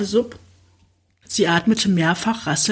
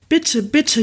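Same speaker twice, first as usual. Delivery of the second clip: whimpering, out of breath; 7 semitones higher than usual. Acted. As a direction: beg between heavy breaths. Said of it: "jammerte sie schwer atmend". (hoch und lauter flehend, schwer atmend)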